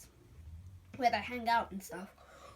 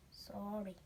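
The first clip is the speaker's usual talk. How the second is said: softer, sorrowful; a sad farewell apology; sad sorry; 2 semitones lower, 9 dB quieter than usual. Acted. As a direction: sigh it sadly. Jesus very sad.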